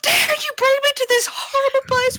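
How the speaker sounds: high, shrill-free scared scolding; scared bickering. Acted. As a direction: bicker at her while frightened. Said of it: "I'm bickering!".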